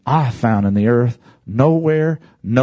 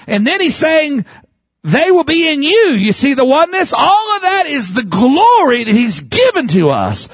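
preaching at full force; 12 semitones higher than usual. Acted. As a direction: preach it out to the whole church. Preaching climax, much higher, louder.

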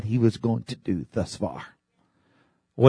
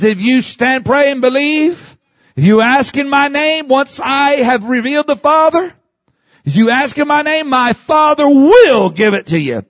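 preaching at full force; 13 semitones higher, 8 dB louder than usual. Acted. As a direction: preach the promise to the crowd. Preaching the Lord's promise.

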